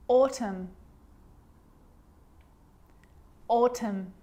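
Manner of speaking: "Autumn" ending on M, with no N; silent N. Shown as a demonstration. Teacher giving silent N examples, careful repeated model. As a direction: model "Autumn" carefully, each time closing on the lips. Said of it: "it actually is silent when it's next to".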